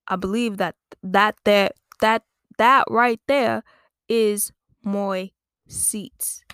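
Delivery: slow, laboured mock-British claim; bad British accent. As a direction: spell it out slowly as an English bloke. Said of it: "that's what the British guy said".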